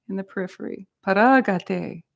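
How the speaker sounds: ordinary delivery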